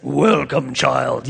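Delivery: lightly voiced deep lion, slow and grand; a hint of the movie's Aslan greeting the girl. Preacher mimics film Aslan greeting Lucy, a lightly voiced lion. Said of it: "I don't know how they can get your voice out".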